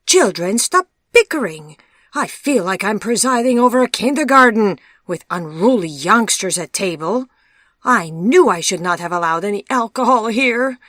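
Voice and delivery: a host at the end of his tether, raising his voice; despairing scold. A narrator's reading. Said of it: "despaired Wolf".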